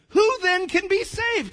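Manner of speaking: high, loud, drawn-out dismay; overwhelmed, high-pitched question; exasperated disciples. Acted. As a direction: cry it out in slow disbelief. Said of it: "Kinda like we're feeling right now".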